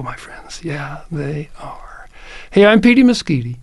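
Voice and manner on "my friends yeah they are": whispering. Real, not acted. Hushed closing about borderlands.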